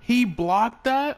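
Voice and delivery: high, loud, drawn-out disbelief; real astonished outburst; shocked disbelief. Real, not acted. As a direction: exclaim it slowly, high and incredulous. Disbelief at a game block.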